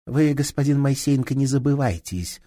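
woman coolly threatening the inspector; cool warning. Acted. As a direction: warn him coldly. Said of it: "хладнокровно ответила".

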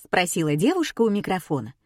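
ordinary delivery, nothing marked

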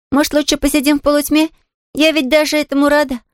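put-on high, apologetic child's voice; rueful little girl. Girl warning the invalid about her freckles, rueful. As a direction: warn her in a high girlish voice.

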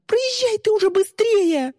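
very high, urgent squealed order; shriek. A narrator's reading. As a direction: screech at the driver to hurry. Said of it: "завизжала Мира". (панический визг)

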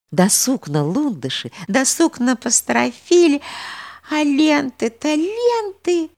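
building excitement, slow and rising. Acted. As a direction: get swept up listing ribbons. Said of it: "всё более и более увлекалась Алёнушка".

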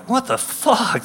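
high, hushed startled question; startled jocks. Retold or imitated. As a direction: recoil at the screaming driver. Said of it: "they're both like".

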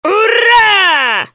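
loud high cheering whoop; gorilla shout. Acted. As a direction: shout hurray like a beast. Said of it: "закричала горилла".

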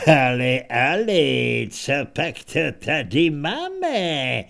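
hlasitě zahájené, pak pomalé a protahované; posměšný vůdce lupičů. Acted. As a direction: vychutnej si kořist jako samolibý bandita. (vůdce lupičů si dobírá stařenku, posměšně a pomalu)

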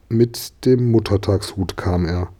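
usual speaking voice

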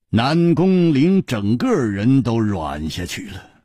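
plain reading voice

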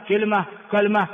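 كلمة twice, silent-vowel lam, first kasra then fatha; two short variants. Shown as a demonstration. Teacher recapping correct variants, "kilma, kalma". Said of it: "هذه كلها لغة صحيحة".

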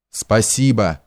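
plain reading voice

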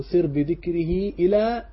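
slow, measured recital of the poem line; reciting the verse. Shown as a demonstration. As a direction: draw the verse out slowly, as if chanting a text. Reading a line of the verse text. (تلاوة بطيئة لبيت من المتن)

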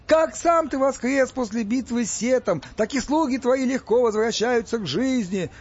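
high, ringing ecstatic praise; excited yell. A narrator's reading. Gopa hails the miracle of Osiris, yelling excitedly. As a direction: proclaim it high and loud like a zealot. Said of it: "заорал Гопа, возбуждённо прыгая".

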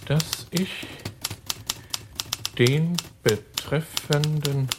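very slow, word by word; slow dictation. Acted. Tom forges Dickie's reply, dictating slowly word by word. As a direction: spell it out as you write it.

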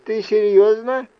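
high squeaky toy voice; squeaky little voice. Acted. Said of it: "пропищал голосок".

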